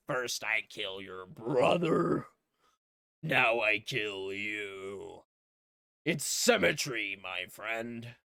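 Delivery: slow, drawn-out mock menace; hammed-up villain. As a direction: gloat like a theatrical movie villain. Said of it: "He has a villain monologue".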